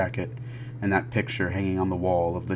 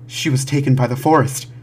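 loud, raised distraught voice; urgent report, pitched up in panic; frantic father; 6 semitones higher, 11 dB louder than usual. Acted. Father telling police of abduction, frantic and loud.

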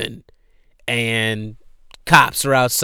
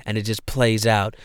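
ordinary delivery

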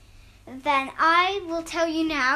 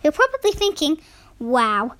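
louder storyteller voice; story narration; 7 dB louder than usual. Acted. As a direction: read it like a bedtime tale. Reads story aloud.

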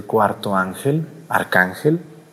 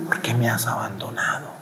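whispered, breathless plea; anguished whisper; 8 dB quieter than usual. Acted. Jesus on the cross, dying, whispers to the Father. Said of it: "¿Qué sentiría Jesús para decirle eso".

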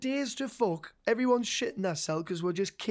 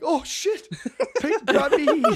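laughing; 11 semitones higher, 6 dB louder than usual. Retold or imitated. Quoted porter, laughing.

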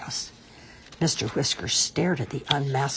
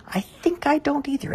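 higher-pitched Maggie voice; echoing little Maggie; 12 semitones higher than usual. A narrator's reading. Maggie echoes Mister Whiskers about ghosts, high-pitched. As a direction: echo it in a much higher voice. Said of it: "echoed Maggie".

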